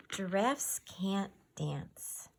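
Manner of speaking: usual speaking voice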